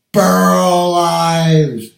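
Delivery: a proud loud shout of the name; calling out the name. Real, not acted. Proudly calls out the singer's name.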